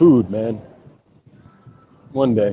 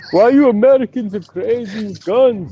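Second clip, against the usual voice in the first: bad, high Jamaican accent, louder; mock Jamaican voice, pitched way up; 11 semitones higher, 4 dB louder than usual. Mocking the Jamaican guy in a bad Jamaican accent. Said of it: "the worst Jamaican accent ever".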